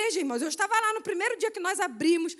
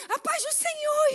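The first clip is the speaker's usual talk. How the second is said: excited greeting; 10 semitones higher, 4 dB louder than usual. Retold or imitated. Church people greeting her warmly.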